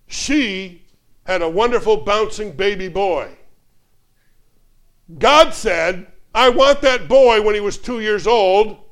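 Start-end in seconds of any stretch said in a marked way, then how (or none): 5.2-8.8 loud, high, slow declaration; preacher driving the story to its climax; rising preaching. Real, not acted. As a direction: build to the turning point with force.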